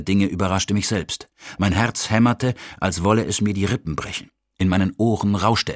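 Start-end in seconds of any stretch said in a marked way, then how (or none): none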